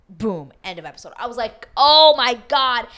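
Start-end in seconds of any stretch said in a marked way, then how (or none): 1.7-2.9 loud, high, drawn-out cry; exasperated outburst. Retold or imitated. Viewer at the end of episode, exasperated cry. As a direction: stretch it out in a loud wail.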